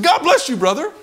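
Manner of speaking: very high, loud mock-indignant blessing; comically strained, high-pitched shout; upset blessing. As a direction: yell the blessing after him, upset. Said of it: "I was upset now. I said".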